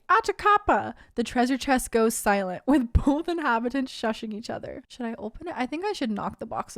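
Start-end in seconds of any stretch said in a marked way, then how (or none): 0.1-0.9 high little alien yelp; Stitch voice. Acted. Stitch calling out from the box. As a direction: chirp it like Stitch. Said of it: "you hear Stitch say".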